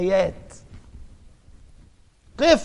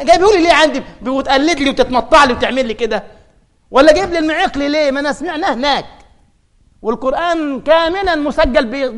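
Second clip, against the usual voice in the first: loud, much higher exasperated rant; exasperated shouting; 4 semitones higher, 5 dB louder than usual. Acted. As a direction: berate the imitator at full force. Scolding imitator, voice rising. (توبيخ بصوت عالٍ وغاضب)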